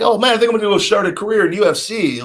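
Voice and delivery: high, rushed mock excitement; mock eager chatter. Imitating fans spreading career rumours, mock eager chatter. Mimicking hyped-up rumour talk.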